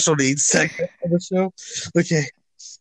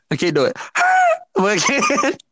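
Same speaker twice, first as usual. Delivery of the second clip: wheeze laugh; 12 semitones higher than usual. Acted. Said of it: "trying to recreate my wheeze".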